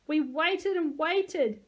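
slow, high, loud wail; plaintive, drawn-out complaint. A narrator's reading. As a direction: draw it out like a hurt child. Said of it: "Max wailed".

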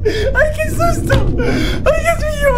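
high shaky laughing relief; laughing from fright. Real, not acted. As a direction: laugh off the scare. After scare, shaky laughter.

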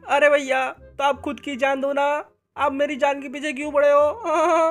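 put-on high childlike sidekick voice; Munna's kid voice. Munna complaining to his brother.

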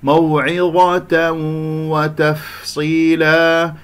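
موعظة وتفصيلا very slowly, a long hum into the و; merging with ghunnah. Shown as a demonstration. Teacher showing tanween before waw, slow nasal glide into وتفصيلا. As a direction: chant the join before وتفصيلا, drawn out. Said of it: "تنوين مع الواو أيضًا إدغام بغنة".

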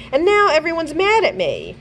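put-on rising, upset child's voice; boy's upset cry to his father. A narrator's reading. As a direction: let the voice climb in distress. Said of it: "his voice rising".

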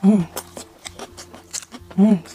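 humming while chewing food; talking between bites; mouth full, chewing. Real, not acted. Seen 2: Bites wrap at -3, chews with closed mouth through labelled words.

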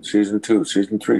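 ordinary talk

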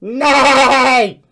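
loud neighing horse call; bouncy playful with animal noises. Pretend. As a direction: shout it like a playful pony. Playing furry in call.

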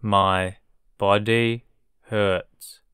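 My, body hurts at half speed; slow model. Shown as a demonstration. Teacher modelling a sentence for learners, very slow. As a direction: drag it out for learners. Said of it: "teacher drilling vocabulary".

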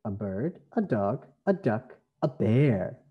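ordinary talk, nothing marked

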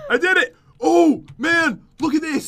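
high, loud burst of triumph; excited triumph. Real, not acted. Player reaches the hard platform, excited triumph. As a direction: crow over the win in short bursts.

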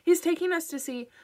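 high, loud rushed warning cut off; sudden urgency. A narrator's reading. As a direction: warn mom before the phone is taken. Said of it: "his voice quickened with sudden urgency".

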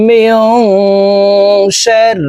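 من شر with the noon hidden in a nasal hum; ikhfa ghunna. Shown as a demonstration. Teacher modelling the hidden nasal noon. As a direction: hum the ن of من شر through the nose. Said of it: "নাকের ভিতরে লুকাইয়া গুন্নার সহিত পড়ব".

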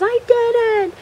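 very high-pitched mock shriek; retold screamed denial. Jennifer protesting to Charlie, retold screamed denial. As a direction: imitate a wrongly accused kid squealing. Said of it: "they're screaming".

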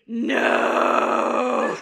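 long, loud comic scream. Retold or imitated. Falling to her knees at the confessional, screaming. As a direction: drag out a guttural yell.

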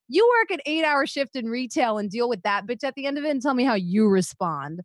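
ordinary delivery

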